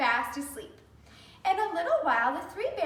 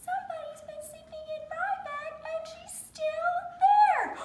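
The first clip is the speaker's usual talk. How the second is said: put-on squeaky baby bear; 13 semitones higher than usual. Baby bear finds Goldilocks.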